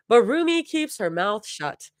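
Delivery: plain reading voice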